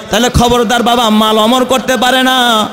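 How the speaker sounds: ordinary talk, nothing marked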